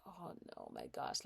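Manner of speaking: under the breath, nearly whispered; a dismayed breath of a thought; hushed dismay. Retold or imitated. Mom to herself, feeling frustration rise: hushed dismay.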